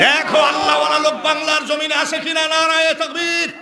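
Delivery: high, ringing slogan call to the crowd; rallying call. Real, not acted. Calls crowd to chant slogan. (উঁচু গলায় স্লোগানের ডাক)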